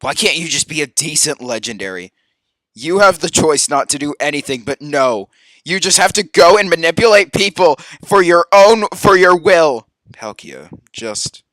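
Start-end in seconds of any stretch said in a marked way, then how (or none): 2.7-9.9 loud, exasperated tirade; loud fed-up rant; angry scolding. Real, not acted. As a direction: tear into the villain.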